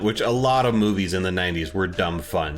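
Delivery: ordinary delivery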